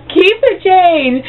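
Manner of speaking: high, slow dismissive keep the change; sassy keep the change. Retold or imitated. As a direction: toss it off with attitude. Storming off.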